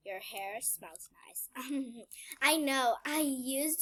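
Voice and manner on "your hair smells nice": slow creepy whisper. Pretend. Whispering to the girl about her hair. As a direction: breathe it close and slow.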